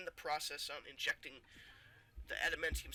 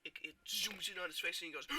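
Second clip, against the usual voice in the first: ordinary talk, nothing marked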